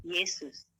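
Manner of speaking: low, soft one-word answer; mysterious voice. Retold or imitated. The voice answering who it is, low and calm. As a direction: state the name quietly, with calm authority. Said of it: "Suara itu bilang".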